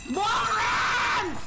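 high-pitched, drawn-out scream; frustrated scream. Real, not acted. Gamer cursing enemies in his way, frustrated scream. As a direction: scream it high until the voice strains. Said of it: "I really gotta stop screaming so much".

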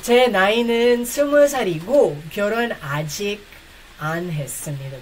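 steady Korean model sentence, fading at the end; sentence demo. Shown as a demonstration. Teacher leading viewers to repeat the age sentence. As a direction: say it clearly for them to repeat. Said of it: "Join with me".

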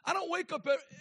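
ordinary talk, nothing marked

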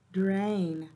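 usual speaking voice

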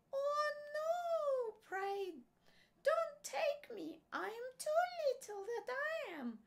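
very high, tiny pleading voice; small goat pleading. A narrator's reading. Youngest billy goat begging the troll, tiny high plea. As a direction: beg in a squeaky little goat voice. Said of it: "said the billy goat".

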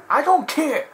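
high, loud scolding referee voice; hammed-up stern referee; reenacted referee. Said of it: "Want me to reenact that bit?".

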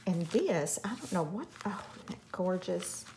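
ordinary delivery

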